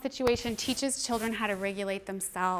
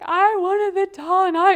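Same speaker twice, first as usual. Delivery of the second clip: put-on slow, much higher, louder wailing child; a crying girl telling the teacher about the doll, slow, higher and louder; upset child; 9 semitones higher, 12 dB louder, slower than usual. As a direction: whimper it out like an upset four-year-old. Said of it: "And she's like".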